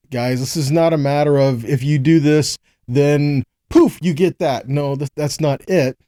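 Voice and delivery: ordinary talk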